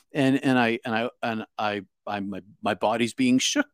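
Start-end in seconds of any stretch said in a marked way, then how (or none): none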